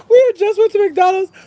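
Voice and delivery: loud, very high mock-tearful wail; high-pitched, tearful-sounding protest, played for laughs; comic wail. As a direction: wail it like a hammed-up injustice.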